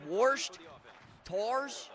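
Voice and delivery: put-on Yinzer accent. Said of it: "My mom talks like a Yinzer".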